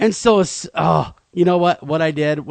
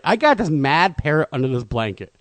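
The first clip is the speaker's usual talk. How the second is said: ordinary delivery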